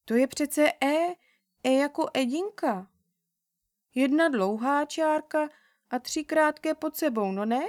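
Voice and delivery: put-on a small girl defending her letter, a bit thrown; taken aback girl. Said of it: "teď už zaraženě řekla".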